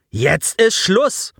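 sehr langsam und höher, streng; anfahrend. A narrator's reading. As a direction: Offizier fährt den Jungen scharf an. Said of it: "fuhr Robespierre ihn an". (leicht gespielte Strenge)

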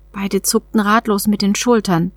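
plain reading voice

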